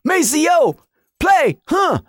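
high funk-singer calls with a grunt. Retold or imitated.

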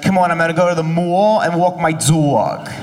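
sing-song Staten Island vowels; Staten Island accent. Retold or imitated. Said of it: "She's like".

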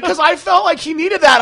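high, loud mock mom voice; imitating mom. Mom explaining to son, high loud mock voice. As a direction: squeal it like his mom. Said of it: "And she was like".

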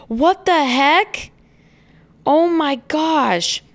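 slow, high, drawn-out amazement; wide-eyed what the heck; stunned disbelief. Real, not acted. Amazed by onion study results.

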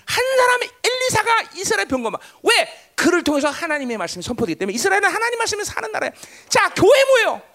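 high, loud pulpit delivery; forceful preaching. Real, not acted. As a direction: preach it with urgent, punched questions.

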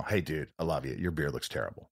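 low, soft, dry email quote; quiet deadpan read. Retold or imitated. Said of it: "the email".

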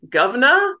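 imitating a Cockney accent, high and loud; son's mock Cockney greeting. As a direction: tease with a cheesy Cockney governor. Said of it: "Like, like some Cockney accent".

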